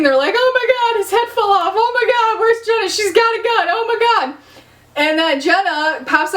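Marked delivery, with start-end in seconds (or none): 0.4-4.4 high, squealy imitation of panicked girls; hammed-up hysteria in a high voice; mock screaming panic. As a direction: rattle off fake panic, pitched way up. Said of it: "The girls are all screaming".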